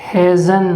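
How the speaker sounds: hazen said as "hez-un"; hasn't clipped to hazen; saying hasn't. Shown as a demonstration. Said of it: "वो आप लोगों का कहलाएगा".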